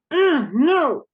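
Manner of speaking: high, loud fed-up "ugh, no"; angry refusal. Shown as a demonstration. Teacher voicing basic anger to the class: loud fed-up refusal. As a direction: push it away like you're getting what you don't want. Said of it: "I'm angry in a basic way".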